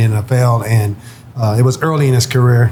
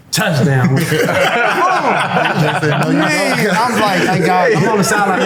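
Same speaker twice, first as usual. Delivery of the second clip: laughing; 7 semitones higher, slower than usual. Real, not acted. Laughing at the touchdown story.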